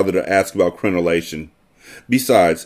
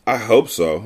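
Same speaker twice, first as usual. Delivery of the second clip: usual speaking voice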